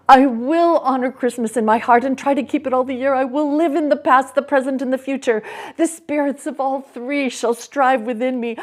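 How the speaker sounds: loud, quick, urgent promise; fervent Scrooge vow. Acted. Scrooge vowing to the ghost in agony, fervent. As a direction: swear it with all your heart.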